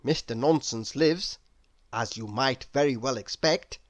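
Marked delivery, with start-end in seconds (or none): none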